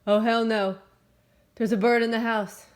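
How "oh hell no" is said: loud dismayed oh hell no; alarmed outburst. Real, not acted. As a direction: blurt it out in alarm. Bird in the house, alarmed.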